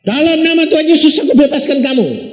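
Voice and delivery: high, loud pronouncing prayer; prayer of release. Retold or imitated. Pastor praying over a sick man, high proclaiming prayer. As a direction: proclaim it like a blessing over the bed.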